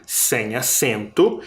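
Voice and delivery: usual speaking voice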